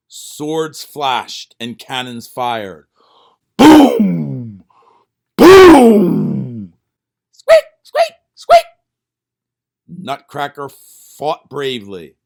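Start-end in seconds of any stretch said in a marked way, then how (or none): 3.6-6.7 loud booming blasts; cannon booms. Acted. Cannons firing in battle. 7.3-8.6 loud, very high frantic squeaking; battle squeaks. Acted. Mice in battle.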